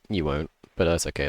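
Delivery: ordinary talk, nothing marked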